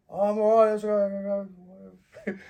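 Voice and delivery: loud, high shout back to his brother; hollered reassurance from a distance; calling out. Retold or imitated. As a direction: yell it across the street, brushing him off. Calling out to brother across street.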